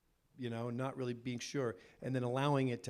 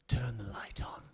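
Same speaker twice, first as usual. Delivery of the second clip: creepy whisper. Retold or imitated. Director creeping up to an actor before the take, whispering. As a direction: imitate a director whispering in an ear. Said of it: "creep up and whisper in your ear".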